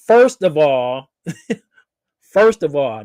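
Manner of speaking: mock sassy rebuttal.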